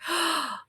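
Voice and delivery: a sharp, high, soft mock gasp; hammed-up relieved gasp; gasp of delight. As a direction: gasp as if the sweet tea were a treasure. Finally finding sweet tea.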